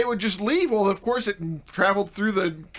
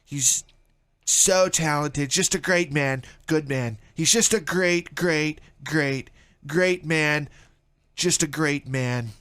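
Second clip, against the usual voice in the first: lightly put-on politician impression, somewhat low; hint of a boastful politician praising someone; Donald Trump; 3 semitones lower than usual. Radio host praising himself as the politician, light impression. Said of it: "Doesn't that sound like something he would say?".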